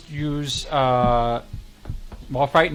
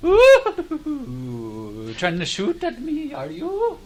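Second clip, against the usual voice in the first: high, sly, taunting creep; creepy villain voice; 13 semitones higher, 6 dB louder, much slower than usual. Acted. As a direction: purr it like a creepy clown. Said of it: "I don't like that guy's voice".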